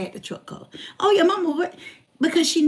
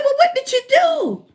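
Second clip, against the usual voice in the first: alarmed question; 8 dB louder than usual. Retold or imitated. Ex-husband asks what she did.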